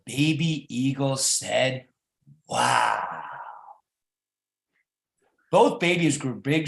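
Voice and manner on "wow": amazed. Acted. Baby eagle amazed.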